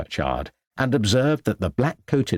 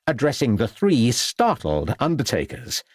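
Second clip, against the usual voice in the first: ordinary delivery, nothing marked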